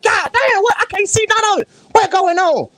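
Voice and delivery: high-pitched exasperated outburst; mock frustration. Host retelling missing the meets on TV, frustrated. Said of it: "I'm sitting here getting mad".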